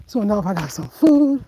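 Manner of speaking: ordinary talk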